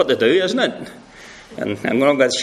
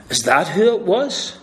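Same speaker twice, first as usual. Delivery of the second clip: doubtful gossip; 4 semitones higher than usual. Acted. Gossiping women voiced by preacher.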